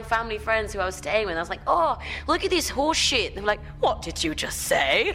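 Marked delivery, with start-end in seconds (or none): 1.7-3.3 very high, loud, slow Australian twang; copied Australian accent. Retold or imitated. Kid swearing to family friends, put-on high Australian accent. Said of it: "I was doing an Australian accent". 3.8-5.1 loud, slow, disbelieving demand; mock shocked. Family friends reacting to a child's swearing, mock outrage.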